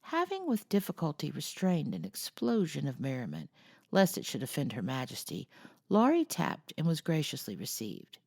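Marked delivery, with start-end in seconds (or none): none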